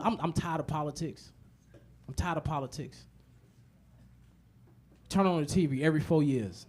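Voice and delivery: ordinary talk, nothing marked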